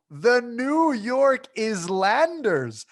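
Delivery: Islanders said as "Iz-landers" with a clear S sound; drawn out slowly, hitting the S in Islanders; S pronounced. Shown as a demonstration. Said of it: "We pronounce the S".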